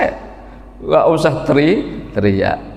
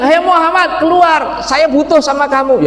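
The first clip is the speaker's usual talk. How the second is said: rude shout; 11 semitones higher than usual. Retold or imitated. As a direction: shout rudely through the door.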